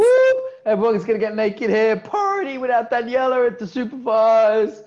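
high, loud, rowdy celebration; gleeful high-pitched hollering; whooping party cheer. Real, not acted. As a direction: shout it like announcing a wild party. Whoops about partying without co-host.

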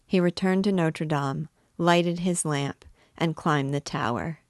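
ordinary delivery, nothing marked

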